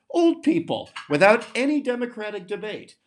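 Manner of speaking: old people jumps way up high, mocking; sarcastic punchline. Mocking the policy's favorite group with a high sarcastic jab.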